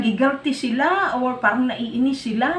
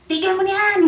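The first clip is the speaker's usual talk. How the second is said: higher, laughing mock shout; mock angry heckler; 6 semitones higher than usual. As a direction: mimic an annoyed heckler while laughing. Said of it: "Mayroon naman".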